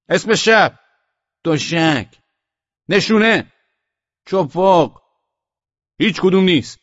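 high, loud short bursts; shouted exchange. A narrator's reading. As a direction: trade challenge and answer across the dark. Shouted password exchange in the dark. (رد و بدل با فریاد)

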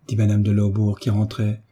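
ordinary delivery, nothing marked

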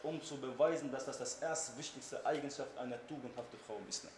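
normal talking voice

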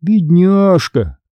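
slow, high-pitched motherly cooing; drawn-out sympathetic coo; tender. A narrator's reading. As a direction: soothe a hurt child tenderly. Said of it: "ласково произнесла она".

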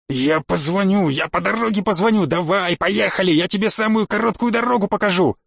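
eager, high-pitched pleading to get moving; agitated and rising, stretched at the start; frantic promising. Acted. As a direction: promise anything just to get going. Frantic passenger hurrying the other.

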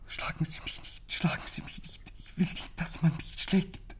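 frightened whisper, pleading. Retold or imitated. Boy retelling his crying father, whispering in fear. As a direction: breathe the words out in terror. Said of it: "immer wieder flüstern".

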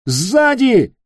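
urgent high-pitched shout; yelled warning. A narrator's reading. Гек alerts Питер to an attacker behind, yelling. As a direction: shout out the danger. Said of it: "заорал Гек".

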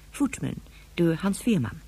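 usual speaking voice

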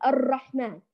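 الرحمن with a rolled, repeating r; over-trill the r in الرحمن; repeated ra. Shown as a demonstration. Teacher warns students against repeating the ra, rolled r. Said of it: "لا نكرر الراء فنقول".

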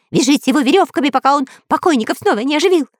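high, rapid-fire squawked orders; frantic commands. A narrator's reading. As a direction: rattle off panicky orders very fast. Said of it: "раздавал он команды из укрытия". (торопливые визгливые команды)

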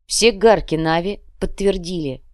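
plain reading voice

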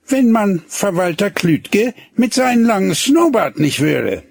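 put-on high girl's voice, sly; needling girl. A narrator's reading. Gretlieschen slyly mentions the rival to Hinnerk. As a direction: drop the rival's name slyly.

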